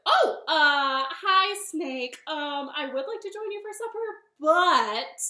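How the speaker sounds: loud, high, hesitant opening; nervous bunny. Acted. Bunny declines snake's supper, startled and hesitant. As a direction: stall brightly, then trail to an excuse. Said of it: "And the bunny said".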